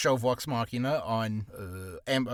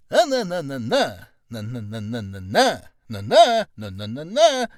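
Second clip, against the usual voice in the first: hammed-up bad reading; 9 dB louder than usual. Actor mocking own bad job auditions, hammed-up delivery. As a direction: overact nonsense syllables, leaping up and punching them. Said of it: "I'm very bad at it".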